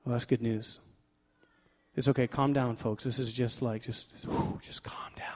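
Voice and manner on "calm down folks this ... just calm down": whispered mock soothing; hushed calming. Preacher teases a quiet congregation to settle, hushed. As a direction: whisper it, as if settling an excited crowd.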